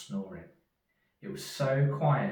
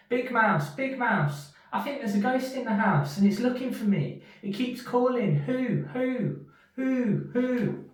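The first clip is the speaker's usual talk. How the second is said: high, scared little mouse crying out; a tiny mouse sure there's a ghost; frightened Little Mouse; 13 semitones higher, 7 dB louder than usual. Acted. Little Mouse cries to Big Mouse about a ghost: high frightened voice. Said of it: "feeling very frightened, he cried".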